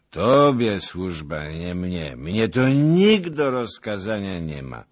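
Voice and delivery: put-on loud, slow, stubborn drunken boast; drunken stubbornness. A narrator's reading. Drunk boasts to lieutenant, loud and stubborn. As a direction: insist on your own freedom, drunkenly. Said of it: "z uporem pijanego człowieka".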